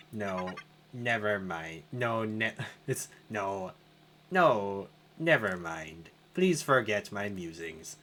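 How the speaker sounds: low, soft musing AI; low hushed computer voice; calm computer voice. Acted. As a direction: speak like a puzzled machine. Tech voice.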